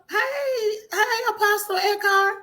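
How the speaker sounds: high, gushing hello; squealing hello to the apostle; excited greeting. Acted. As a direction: gush at the famous caller.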